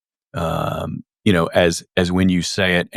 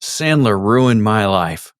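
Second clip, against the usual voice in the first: drawn-out, raised-voice heckle from the back; slow mock yell, pitched up a little; retold yell; 3 semitones higher, 4 dB louder, slower than usual. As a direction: imitate someone yelling across a room, stretching the words. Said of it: "he yells out".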